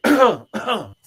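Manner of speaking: loud, staged throat-clearing cough; exaggerated attention-getting cough; loud fake cough. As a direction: cough loudly on purpose. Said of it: "like, cough loud".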